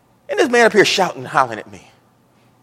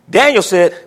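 normal talking voice

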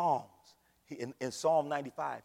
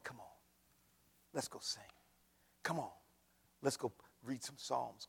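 soft, low, slowing appeal sinking to a whisper; quiet pastoral invitation to the church; tender altar call; 5 semitones lower, 9 dB quieter than usual. Acted. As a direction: pull them in gently toward worship.

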